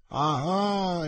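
a sharp, struck exclamation going up high; exclaimed. Acted. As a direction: exclaim it as the meaning dawns. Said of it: "esclamò D'Artagnan".

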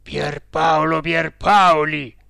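loud, slow séance summons; cook at séance. A narrator's reading. Cook calling up the dead founder at midnight, exclaiming loudly. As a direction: call out slowly to a spirit, with force. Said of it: "disse l'odiato manipolatore".

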